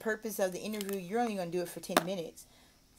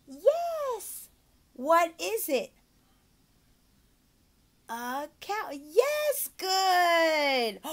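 high, loud praise; hammed-up teacher cheer; praise child; 7 semitones higher, 6 dB louder, much slower than usual. Shown as a demonstration. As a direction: celebrate every answer. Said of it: "teacher praising pretend student".

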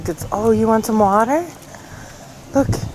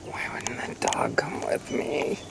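whisper; 7 semitones lower, 15 dB quieter than usual. Real, not acted. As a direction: whisper so nothing hears. Whispering alone among graves.

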